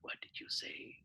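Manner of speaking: imitated baffled whisper; whispered puzzlement. Puzzled viewers reacting to calm jargon.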